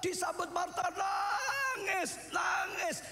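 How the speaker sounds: high, ringing retelling of the story; preaching at full force. Acted. Preacher telling how Jesus wept, fervent and high. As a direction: cry the story out to the hall. Preacher tells mourning at Lazarus.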